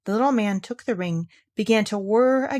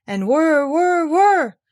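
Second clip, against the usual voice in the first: rising, louder whir whir whir; whirring wheel; 8 semitones higher, 6 dB louder, much slower than usual. A narrator's reading. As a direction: make the spinning wheel hum. Spinning wheel sound effect.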